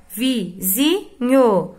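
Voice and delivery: vizinho said very slowly, syllable by syllable; slow example word. Shown as a demonstration. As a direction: spell out vizinho slowly for pupils. (palavra dita bem devagar)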